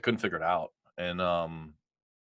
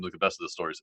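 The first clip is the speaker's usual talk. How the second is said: usual speaking voice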